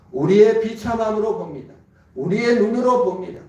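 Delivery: normal talking voice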